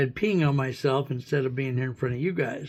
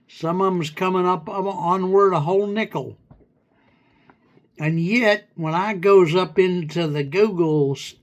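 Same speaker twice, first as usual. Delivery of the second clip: pretend hillbilly; 2 semitones higher than usual. Complaining to the AI about TikTok money in a light hillbilly accent. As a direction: ham up a confused country bumpkin, lightly. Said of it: "pretend hillbilly slang".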